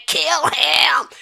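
high, loud mock doe egging on a fight; bloodthirsty cheering. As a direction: yell it as a hyped-up spectator.

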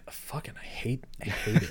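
whispered grumble; very soft, whispery muttering; muttered envy. Real, not acted. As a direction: grumble under your breath, almost voiceless. Joking envy of youthful-looking actors.

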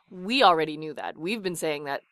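normal talking voice

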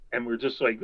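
ordinary talk, nothing marked

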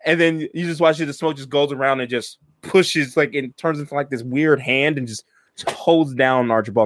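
normal talking voice

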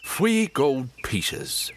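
very slow, drawn-out bad Cockney accent; Michael Caine accent. Game master showing off horrible accent, exaggerated Cockney. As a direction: stretch each word in a hammy Cockney accent. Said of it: "Get a bit of Michael Caine in there".

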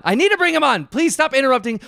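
losing patience, voice shooting up; exasperated high yelp. Real, not acted. Host exasperated, repeats himself.

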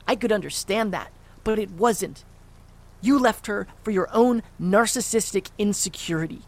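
loud, raw yelling at his father; furious shout. Acted. Son yelling at the father who walked out, furious.

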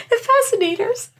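high-pitched mock British; mock British accent. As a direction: say it in a playful fake English accent. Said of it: "I like your British voice".

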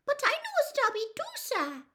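very high, quick little squeak; squeaky elf. A narrator's reading. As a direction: pipe up fast as a tiny house elf. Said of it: "squeaked the elf".

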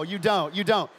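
ordinary delivery

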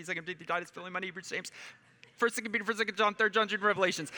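rattled off fast, fading breath; all in one breath. Shown as a demonstration. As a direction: rush through without breathing. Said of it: "you wanna hear it?".

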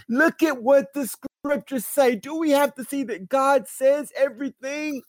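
high, ringing pulpit challenge; fervent preaching. Real, not acted. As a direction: throw the question at the congregation.

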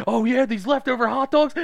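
high, loud manic voice; gleeful menace, pushed high; unhinged boss. Acted. Supervisor snapping on the kids, manic and high. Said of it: "Just snapping".

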